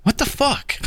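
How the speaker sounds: high incredulous what the fuck; mock disbelief. Friend retelling reaction to movie ending, high mock disbelief. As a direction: act baffled at the screen.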